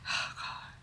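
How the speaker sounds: breathy, unvoiced exasperation; whispered oh God. Rehearsing a line, exasperated whisper at her sister. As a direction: whisper it in disbelief. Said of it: "I gotta say".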